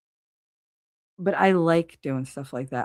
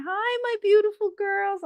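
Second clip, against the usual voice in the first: high, sing-song cooing to her pet hens; sweet greeting to chickens. Retold or imitated. A woman cooing hello to her chickens, high and sweet. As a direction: coo it like greeting beloved pets. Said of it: "I always go out and say".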